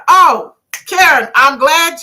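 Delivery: high, loud, slow "Oh, Karen"; calling out to a viewer with delight; excited call out. Real, not acted.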